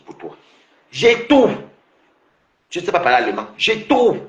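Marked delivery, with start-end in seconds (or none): 0.9-1.5 high, punchy blurt; mock answer, pitched high; mimicked reply. Mimics a non-French speaker answering. 3.6-4.2 high, punchy blurt; mock answer, pitched high; mimicked reply. Mimics a non-German speaker answering.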